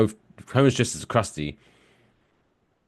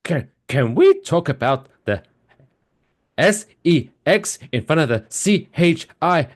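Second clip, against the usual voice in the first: high, loud prim churchy mother spelling out words; prissy pious mom, much higher; Maud Flanders; 8 semitones higher, 5 dB louder than usual. Retold or imitated. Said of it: "Maud Flanders goes".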